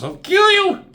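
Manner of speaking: acting out an enraged outburst; mad. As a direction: shout it as a hammed-up death threat. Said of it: "He's mad".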